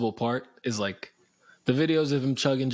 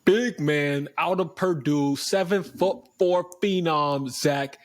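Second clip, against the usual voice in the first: announcer intro; much slower than usual. Acted. Introducing prospect hype.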